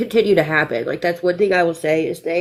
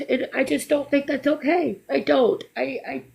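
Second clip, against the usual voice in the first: fighting back real tears; trying not to cry; 6 semitones higher than usual. Real, not acted. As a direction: voice close to breaking. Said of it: "I'm trying not to cry".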